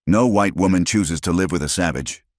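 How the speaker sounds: ordinary delivery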